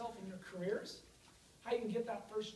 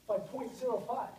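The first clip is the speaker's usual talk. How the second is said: normal talking voice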